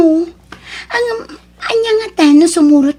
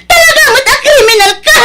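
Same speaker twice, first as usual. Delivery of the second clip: fast, higher, louder crying yell; tearful shout; 11 semitones higher, 8 dB louder, faster than usual. Acted. Wife shouting back at husband, crying. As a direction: fling the accusation back.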